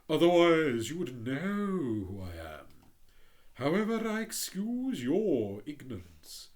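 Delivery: hint of a haughty rocket; lightly voiced pompous Rocket. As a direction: read it as a vain firework showing off. The Rocket boasting, slow.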